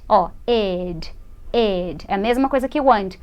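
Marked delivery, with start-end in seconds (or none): 0.5-2.0 lower, drawn-out "ed" on its own; isolated ED ending. Shown as a demonstration. Teacher points out the ending to learners, isolated "ed". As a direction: isolate "ed" as a full syllable. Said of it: "É D, som de D".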